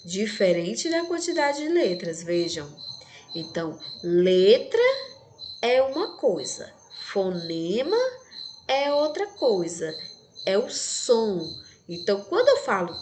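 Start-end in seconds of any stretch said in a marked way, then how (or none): none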